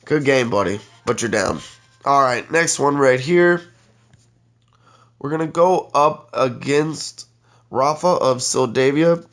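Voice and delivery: normal talking voice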